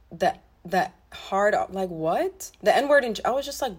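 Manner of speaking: ordinary talk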